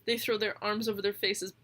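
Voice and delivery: usual speaking voice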